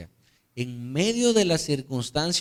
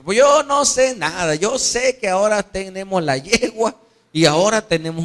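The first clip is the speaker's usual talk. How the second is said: loud, high retort; defiant son; 4 semitones higher, 5 dB louder than usual. Acted. Son dismissing his father's wise words, defiant. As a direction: brush off the advice with youthful insistence.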